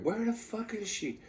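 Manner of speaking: high but soft mock exclamation; retold alarm. Retelling her search for dog.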